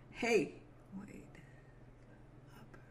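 ordinary talk

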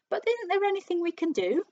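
high, loud pleading question; desperate cry. A narrator's reading. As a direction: beg for some way out. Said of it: "cried Missus Twit".

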